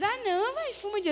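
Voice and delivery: high-pitched imitation of pushy friends; amigos insistindo. Imitates friends pressing her to smoke. (voz aguda imitando amigos insistentes)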